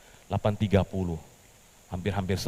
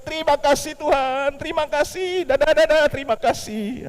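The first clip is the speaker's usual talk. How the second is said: much higher, louder giddy waving thanks; 7 dB louder than usual. Acted. Petrus soaked again but still thanking God, giddy. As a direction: sing out thanks to everyone.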